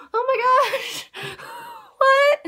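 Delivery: drawn-out high gasp breaking into a laugh; shocked squeal. Retold or imitated. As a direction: shriek it out like the show just floored you. Shocked reaction to serious episode.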